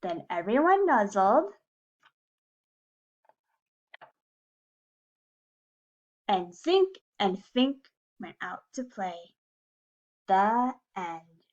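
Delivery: put-on reading to children. A narrator's reading. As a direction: read it like story time for little kids. Reading a picture book to class.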